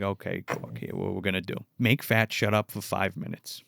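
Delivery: low, soft, measured reply; calm challenge. Retold or imitated. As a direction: imitate an unimpressed manager, quietly. Calm manager answers frantic Corky.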